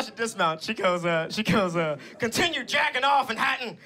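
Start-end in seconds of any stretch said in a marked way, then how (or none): 2.2-3.8 high-pitched threatening aunt; Aunt Kathy. Retold or imitated. Aunt warning her nephew's hater, mock menacing rant. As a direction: imitate an aunt building to a threat, pitch climbing. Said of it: "and this is how she talks".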